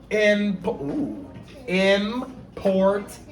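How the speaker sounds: "Important" read out slowly after a false start on "Imp-"; modelling reading. Shown as a demonstration. Teacher models reading "Important" slowly for students. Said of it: "So watch me read".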